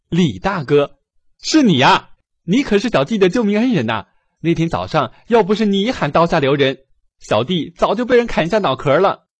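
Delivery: high, loud joyful cry; excited exclamation. Acted. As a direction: call out in glad surprise. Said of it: "突然高兴地叫起来".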